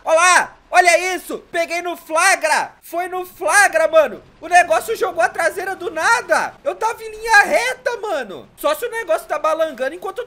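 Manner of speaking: loud, worked-up shouting at the glitch; excited outcry. Real, not acted. Player catching his truck glitching, loud excited outcry. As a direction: yell it like you caught the bug red-handed. Catches the game bugging live.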